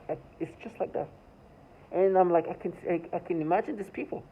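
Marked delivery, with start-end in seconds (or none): 0.1-1.1 soft breathy whisper demo; showing how the voices whisper. Shown as a demonstration. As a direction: breathe it out like a voice in your head. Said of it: "They start whispering like this".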